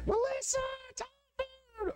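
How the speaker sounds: high yell across the house calling a name; shrill-pitched call up the stairs, held out long; kids yelling. Retold or imitated. Kid yelling to a sibling that the phone is for them, high call. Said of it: "the kids would yell".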